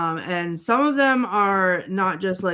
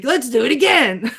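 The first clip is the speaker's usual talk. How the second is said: loud, eager movie-character quote; excited imitation, laugh in the voice; Donkey; 2 semitones higher, 6 dB louder than usual. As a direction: exclaim it with laughing glee. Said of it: "Donkey's like".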